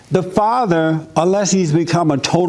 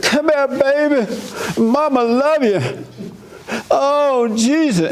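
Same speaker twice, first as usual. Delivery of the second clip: much higher, slow, gushing mother; smothering mother cooing over her baby; fussing mama; 9 semitones higher, slower than usual. Retold or imitated. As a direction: fuss over the fallen baby like a panicked mama. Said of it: "But mama run and grab you".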